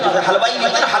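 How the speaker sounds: normal talking voice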